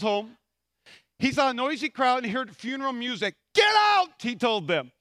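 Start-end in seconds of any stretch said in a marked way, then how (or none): 3.5-4.2 high, loud dramatized yell; shouted order. Retold or imitated. Jesus clearing mourners from the house, acted shout. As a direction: bellow it as a command.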